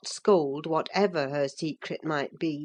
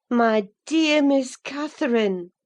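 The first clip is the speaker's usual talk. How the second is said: raised voice, kindly, holding back a scold; gentle housekeeper; 8 semitones higher, 5 dB louder than usual. A narrator's reading. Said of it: "too vividly impressed by her recent kindness to break into a scold".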